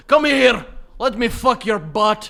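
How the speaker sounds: high, bellowed Scottish come-on; loud Scottish accent. Acted. Failed seduction as a Scot, loud hammed-up Scottish accent. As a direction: blare it in broad, drawn-out Scots. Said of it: "can't say anything sexy with a Scottish accent".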